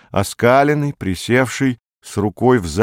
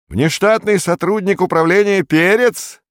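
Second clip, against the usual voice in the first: much higher, ringing announcement; clear ringing voice; 11 semitones higher, 3 dB louder than usual. A narrator's reading. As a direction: director hailing a visitor, high and resonant. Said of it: "чистым звонким голосом произнёс директор". (чистым, звонким, высоким голосом)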